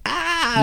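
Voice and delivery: high yelp with a laugh and cry in it; retold yell of pain. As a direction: let out a pained half-laughing yell. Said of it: "'cause I'm, like, yelling".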